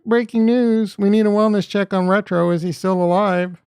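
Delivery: loud, high mock breaking-news call; mock news anchor. Streamer jokes about missing viewer, mock anchor, loud and high. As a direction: announce it like a hyped anchor.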